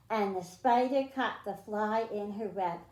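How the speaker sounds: usual speaking voice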